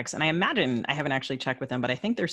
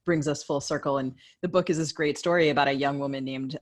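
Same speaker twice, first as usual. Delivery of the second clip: ordinary talk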